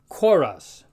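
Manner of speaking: chorus opening on a breathy K; chorus punched out with an aspirated K, "kho-roos". Shown as a demonstration. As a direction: say chorus with K, never tch. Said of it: "more like a K with an H".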